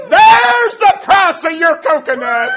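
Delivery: drawn-out, high pulpit shout; triumphant preaching shout. Retold or imitated. Preacher reveals the conscience's answer, full shout. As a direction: cry it out slowly, full force. Climax of story shouted to congregation.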